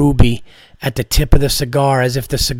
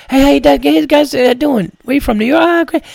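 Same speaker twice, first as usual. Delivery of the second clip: much higher, louder glad-handing voice; slick politician greeting; 11 semitones higher, 4 dB louder than usual. Retold or imitated. Comic imitating the tanned owner greeting the New York comedians like a slick politician. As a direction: greet everyone like a smooth politician. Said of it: "He was such a politician".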